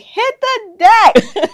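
loud, high, drawn-out yell; retold yell. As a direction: yell it like a warning, stretching the words. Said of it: "and somebody, like".

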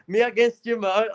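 normal talking voice

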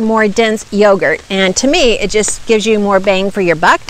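usual speaking voice

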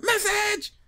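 very high-pitched comic yell; Keenen Ivory Wayans yell. Retold or imitated. Popping out to flag the message, imitated yell. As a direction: burst in with a hollered word. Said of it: "Keenen Ivory Wayans moment here".

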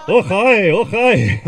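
loud, high hammed-up Scottish "Och, hi" with a laugh; mock Scottish greeting. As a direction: ham up a Scottish brogue, laughing. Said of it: "particularly Scottish".

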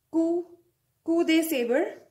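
careful teacher's "coup", pause, "coup de sabre"; pronunciation demo. Shown as a demonstration. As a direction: say "coup" alone, then "coup de sabre" in full. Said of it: "We pronounce it as".

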